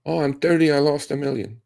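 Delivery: high-pitched imitation of a whining loser; mock complaining. Mimicking a complaining young investor.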